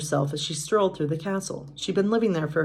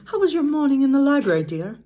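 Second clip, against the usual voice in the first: much higher, louder motherly character voice; voiced Mrs. Potts; 8 semitones higher, 6 dB louder than usual. A narrator's reading. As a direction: ask it high and loud, dropping on "dear".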